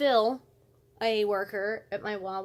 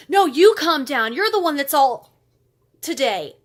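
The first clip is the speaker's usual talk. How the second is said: imitating a coworker's loud, heated comeback; mock yell, higher and much louder; snapping back; 4 semitones higher, 11 dB louder than usual. Coworker told to calm down by the narrator, snapping back.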